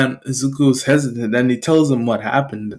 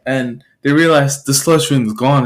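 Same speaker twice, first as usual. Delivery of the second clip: plain reading voice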